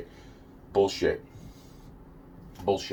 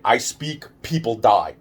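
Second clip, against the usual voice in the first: ordinary talk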